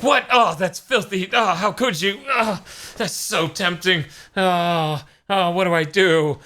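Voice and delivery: high, hammed-up tortured whine; mock tempted Satan. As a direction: ham up a devil torn by temptation. Satan tempted, acted mock torment.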